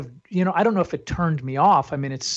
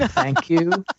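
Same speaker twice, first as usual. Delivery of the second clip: "Thank you" lifted much higher in a bright chirp; high sing-song thank you. Shown as a demonstration. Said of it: "knowing how to say".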